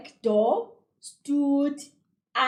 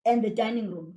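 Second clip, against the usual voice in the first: usual speaking voice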